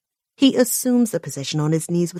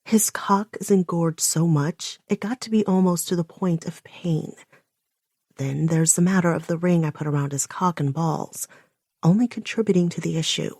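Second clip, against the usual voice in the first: usual speaking voice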